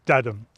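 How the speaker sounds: "da-dum" with weak then strong beat; da-dum rhythm. Shown as a demonstration. Teaching students the iamb, da-dum beat. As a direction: tap out "da-dum", stress the dum. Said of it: "weak stress followed by a strong stress".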